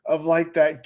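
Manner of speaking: ordinary delivery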